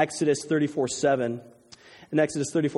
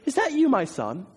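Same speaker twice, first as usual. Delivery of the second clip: high-pitched mock quote, not shouted; incredulous requote; 9 semitones higher than usual. Preacher marvels at Saul's response, quoting it incredulously. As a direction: echo the question as if amazed by it.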